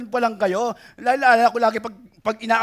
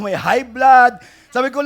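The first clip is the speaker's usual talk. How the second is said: normal talking voice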